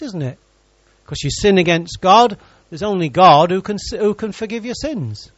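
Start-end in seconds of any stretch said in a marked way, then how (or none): none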